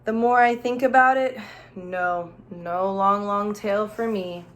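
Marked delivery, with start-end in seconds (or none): none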